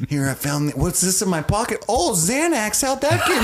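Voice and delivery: put-on high-pitched comic Minnesota accent. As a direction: play it folksy Minnesotan, rising into mock surprise. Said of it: "why he's from Minnesota now".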